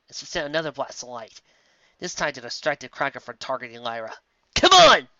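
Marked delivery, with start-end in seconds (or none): 4.6-5.1 high, loud urgent yell; loud commanding shout. A narrator's reading. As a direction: yell at them to run. Urgent call.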